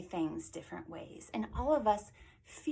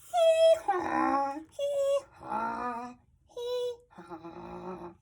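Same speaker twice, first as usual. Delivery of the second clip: silly donkey bray; 3 semitones higher, 5 dB louder, much slower than usual. Acted. Reader shows children a donkey's hello, braying. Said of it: "is silly and happy".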